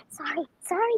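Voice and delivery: quick whispered sorry, sorry. Real, not acted. Whispered apology to the chat mid-rant. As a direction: breathe the apology without voice. Apologizing under her breath.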